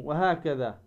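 ordinary delivery

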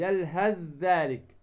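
slow "يلهث ذلك" as yalhadh-dhalik; tha merged into dhal. Shown as a demonstration. Teacher restating the rule, يلهث ذلك with held merged ذ. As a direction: voice the ث of يلهث into a doubled ذ. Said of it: "فتدغم الثاء في الذال".

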